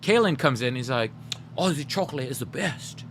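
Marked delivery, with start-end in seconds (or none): 1.6-3.1 softer, badly faked Australian accent; attempted Aussie accent. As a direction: have a go at an Aussie accent you can't do. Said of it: "how to do an Aussie accent".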